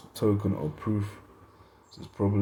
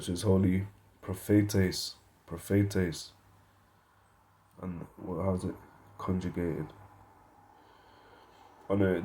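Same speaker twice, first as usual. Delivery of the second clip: usual speaking voice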